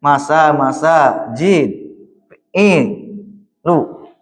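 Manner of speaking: "masajidu" broken into "Masa, jid, i, lu" with pauses; syllable by syllable. Shown as a demonstration. Teacher breaking the word into syllables for the student. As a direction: spell the word out in spoken syllables. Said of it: "Dibacanya patah semuanya kan?".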